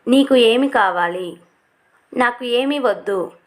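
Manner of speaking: normal talking voice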